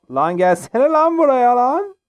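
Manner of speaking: high, loud taunting call; taunting shout. Real, not acted. Player challenging an opponent, loud taunting yell. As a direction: yell at the enemy to come over.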